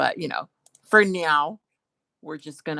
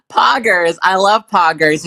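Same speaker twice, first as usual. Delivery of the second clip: mock enthusiasm; 10 dB louder than usual. Feigned enthusiasm for unknown slang.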